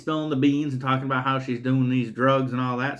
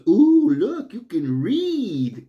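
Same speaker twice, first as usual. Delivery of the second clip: much higher, very slow, louder mocking coo; mocking sneer; 7 semitones higher, 5 dB louder, much slower than usual. Killer mocking the captive, in a high drawn-out sneer. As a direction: sneer it out like a sarcastic villain.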